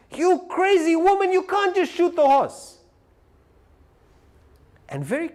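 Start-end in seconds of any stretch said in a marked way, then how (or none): 0.1-2.8 much higher and louder mock scream; screaming husband. After she shoots the horse, the husband shrieks a mock scream at his wife. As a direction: shriek at her like an appalled husband. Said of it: "the husband starts screaming".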